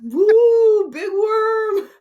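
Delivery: high, loud whooping cheer; mock whoop. Imagining Big Worm shirtless, loud high whooping cheer. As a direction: cheer it while swinging your shirt. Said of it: "just show off his titties and just".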